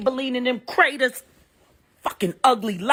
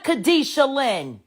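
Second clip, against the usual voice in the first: ordinary delivery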